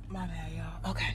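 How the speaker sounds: hushed, quick whisper. Real, not acted. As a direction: whisper so no one nearby hears. Hiding the filming in public.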